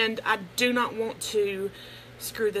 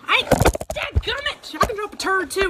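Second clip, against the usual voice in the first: playful outburst; 9 semitones higher, 5 dB louder than usual. Real, not acted. Reacting to turtle emoji joke.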